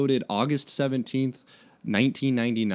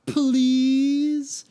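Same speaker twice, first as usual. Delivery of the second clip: pleading whine; 5 dB louder than usual. A narrator's reading. Mike begging.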